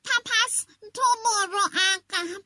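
rooster puffing up, a bit nervous. Acted. Rooster telling the fox to step back, high and stammering. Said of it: "خروسه بالا پرش رو جمع کرد و گفت".

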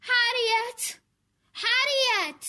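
loud, high shout of a name; calling out. A narrator's reading. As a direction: call out as a father searching for his child. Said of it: "داد زد".

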